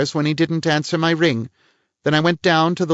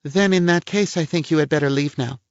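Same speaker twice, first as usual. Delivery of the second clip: cold; 9 semitones higher than usual. A narrator's reading. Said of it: "Her voice was cold".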